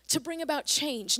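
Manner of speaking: usual speaking voice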